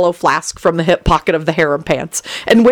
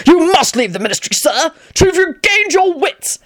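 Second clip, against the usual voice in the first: higher, stern official voice; stern officer; 11 semitones higher than usual. A narrator's reading. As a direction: lay down the rule firmly.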